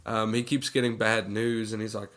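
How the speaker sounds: normal talking voice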